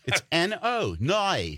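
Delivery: high, drawn-out mock Australian; Australian accent. Australian insisting to a confused listener, high Australian accent. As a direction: insist on "no" as a stretched Australian "noy". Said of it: "Australian people, when they say no".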